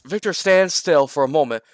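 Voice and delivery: ordinary delivery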